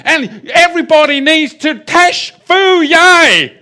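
loud, high Arkansas preacher impression; Arkansas accent. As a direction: holler it slowly in a mock Arkansas accent. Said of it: "in an Arkansas accent".

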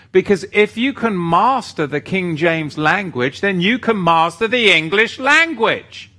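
worked-up, emphatic preaching with rising pitch; fired up. Real, not acted. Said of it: "I always fire myself up over everything".

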